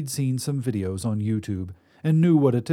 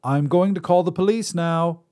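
raised, higher warning call; 7 semitones higher, 4 dB louder than usual. Acted. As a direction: call it across the lawn firmly. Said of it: "then called out".

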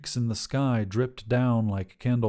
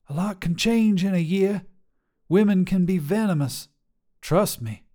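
higher, bold trucker woman voice; tough lady trucker; 8 semitones higher, 3 dB louder than usual. Acted. Trucker Sharon sizing up a hitchhiker, brash and higher. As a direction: call out like a brash long-haul trucker.

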